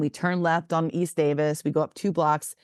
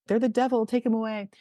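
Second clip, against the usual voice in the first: raised-pitch imitation of alarmed sisters; mock warning, pitched up; 6 semitones higher than usual. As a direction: lightly act out superstitious alarm, higher voice. Sisters acted removing the boards.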